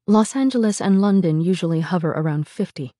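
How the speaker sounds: ordinary delivery, nothing marked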